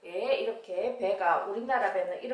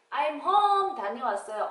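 put-on child arriving home; 3 semitones higher, 4 dB louder than usual. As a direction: sing out your arrival. Child greeting mom, recap of dialogue.

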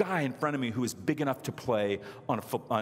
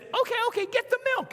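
very high squeaky wife; tiny little voice; faster than usual. Retold or imitated. Tiny wife ordering her husband about, high squeaky voice. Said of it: "this tiny little voice".

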